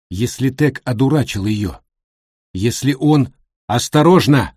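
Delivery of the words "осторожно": shouted warning. Acted. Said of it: "кричит Джонни".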